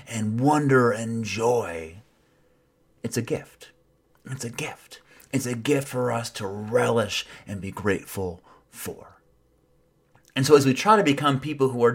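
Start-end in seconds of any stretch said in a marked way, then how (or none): 3.0-9.2 soft and intimate; tender. Acted. Preacher softly telling listeners life is a gift, tender. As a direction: let it land gently, softer each time.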